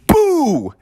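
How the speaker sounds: sudden high jump-scare boo; Bubby leaping from the bushes with a high boo; playful boo. Acted. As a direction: pop out and spook them. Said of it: "he popped out and said".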